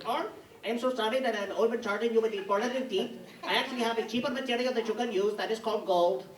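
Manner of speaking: put-on light Indian accent, polite and apologetic. Dentist from India politely offering a cheaper material, in an Indian accent. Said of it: "she's from India".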